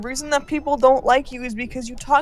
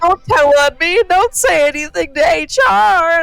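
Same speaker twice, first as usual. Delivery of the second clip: pleading coworker imitation; 6 semitones higher, 7 dB louder than usual. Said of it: "she'll be like".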